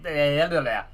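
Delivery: ordinary delivery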